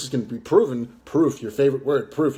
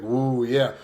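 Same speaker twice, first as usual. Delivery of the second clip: ordinary talk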